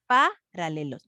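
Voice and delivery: Paralelos with PA punched, then a drop; wrong stress on PA. Shown as a demonstration. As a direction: test it as PA-ralelos, slowly. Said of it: "No, no se escucha bien".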